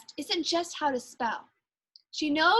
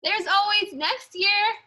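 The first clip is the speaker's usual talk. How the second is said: higher, louder and slower called out; yelled encouragement; 7 semitones higher, 4 dB louder, slower than usual. A narrator's reading. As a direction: call after a running kid. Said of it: "heard her yell after".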